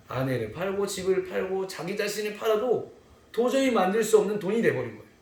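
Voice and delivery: ordinary talk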